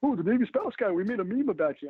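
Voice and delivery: high, gleeful mock voice; excited meme makers. Retelling the meme page teasing the Navy spouse guy, high gleeful voice. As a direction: squeal it like gossip thrilled with a find.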